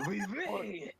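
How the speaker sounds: hammed-up French oui; a quick mock-French oui. Joking about drinking French wine, mock French accent. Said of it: "get these guys some French wine".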